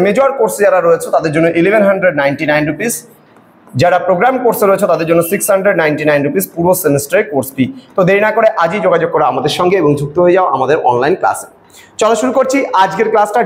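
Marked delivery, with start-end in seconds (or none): 0.1-11.5 quick promo voice, rattling off fees; brisk advertisement delivery; ad read. Acted. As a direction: sell the course fast like a radio ad. Teacher reads course ad.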